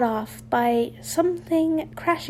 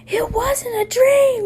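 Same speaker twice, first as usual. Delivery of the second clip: high, loud shriek of realisation; 11 semitones higher, 7 dB louder than usual. A narrator's reading. Ron finding the midnight note, shrieking. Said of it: "he shrieked".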